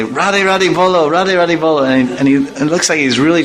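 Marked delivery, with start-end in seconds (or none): 0.0-1.8 high coaxing call; sing-song coaxing; coaxing the dog. Retold or imitated. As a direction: urge the dog to say Radhe. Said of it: "I tell him, I say".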